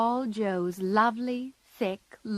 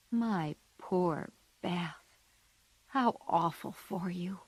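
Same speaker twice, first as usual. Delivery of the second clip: tender; 6 dB quieter than usual. A narrator's reading. Comforting a grieving sister.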